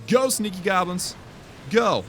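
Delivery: excited rally cry; cheering on troops. Real, not acted. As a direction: urge the goblins on.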